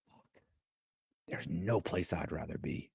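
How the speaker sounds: hushed inner thought. Retold or imitated.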